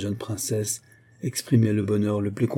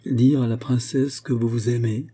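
plain reading voice

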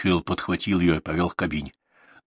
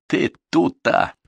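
mocking echo; 9 semitones higher, 3 dB louder, much slower than usual. A narrator's reading. Mikhail mocks her word again.

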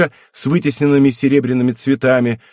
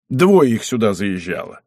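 ordinary delivery, nothing marked

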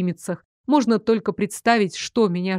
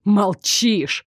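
spat out. A narrator's reading. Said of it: "сплюнул учитель".

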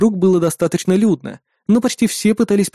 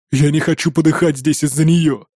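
usual speaking voice

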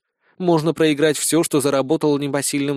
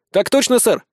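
crisp, higher reply; military report; 7 semitones higher, 3 dB louder than usual. A narrator's reading. Recruit reports to the sergeant, crisply. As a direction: snap back a soldier's report. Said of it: "отрапортовал я".

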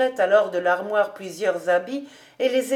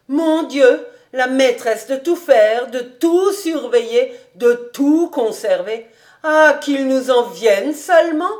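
higher, louder, exclaiming voice; fervent old housekeeper; 4 semitones higher, 6 dB louder than usual. Acted. Housekeeper wishing for a young mistress to relieve her, fervent. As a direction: plead heartily, stressing each repeated phrase.

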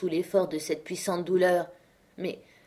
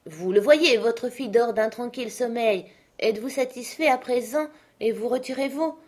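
raised voice, forced calm lie; feigned reassurance; 6 semitones higher, 3 dB louder than usual. A narrator's reading. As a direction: soothe him falsely. Benjamin lies to the father.

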